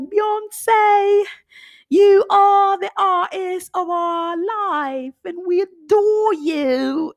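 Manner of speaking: high, slow, gushing tribute voice. Retold or imitated. [name] at the Grammys gushing to [name], high and slow. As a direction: drag it out high, full of awe. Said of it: "she like".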